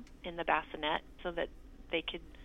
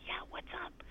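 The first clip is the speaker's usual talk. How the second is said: high, hushed whisper; dad whispering back in the silent clinic; 13 semitones higher, 8 dB quieter than usual. Retold or imitated. There is a hush.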